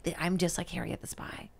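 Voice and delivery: soft dreamy whisper. Retold or imitated. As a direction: whisper it like a secret. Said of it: "I was like".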